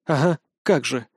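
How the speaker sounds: mildly grumpy disbelief; displeased. A narrator's reading. Lina answers the inspector's excuse, displeased. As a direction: scoff with mild displeasure. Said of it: "недовольно отозвалась Лина".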